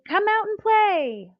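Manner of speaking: high, loud, slow sing-song call; friends calling out. Acted. Friends calling the lazy Schlumps outside, high sing-song call. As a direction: call them out to play like eager friends. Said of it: "Their friends came to say".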